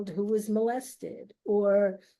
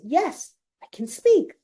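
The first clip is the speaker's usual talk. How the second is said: rising, louder relieved answer; relieved joy; 5 semitones higher, 5 dB louder than usual. Retold or imitated. Andrea telling Gail she can speak, relieved and excited. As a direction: burst out with relief.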